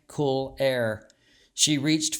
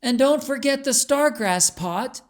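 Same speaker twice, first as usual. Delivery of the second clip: much higher, louder call after someone; calling down the hall; 11 semitones higher, 5 dB louder than usual. A narrator's reading. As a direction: shout it after her down the corridor. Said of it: "Beatrice called down the hall".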